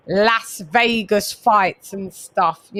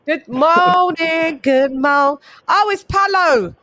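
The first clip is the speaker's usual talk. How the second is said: excited greeting; 4 semitones higher than usual. Real, not acted. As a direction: hail a friend who just turned up. Greets Palo arriving in chat.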